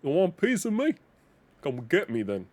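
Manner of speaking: ordinary talk, nothing marked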